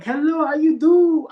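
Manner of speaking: high, loud singsong greeting; restaurant lady. Retold or imitated. Restaurant lady greeting a customer, high chirpy voice. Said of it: "The lady, she's like".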